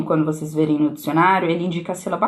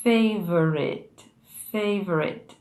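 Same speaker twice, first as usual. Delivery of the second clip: "Favorite" slowly, FAY stretched long; stressed syllable lengthened; much slower than usual. Shown as a demonstration. Teacher showing elastic exercise, "favorite" with long FAY. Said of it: "exercício legal de você pronunciar a palavra".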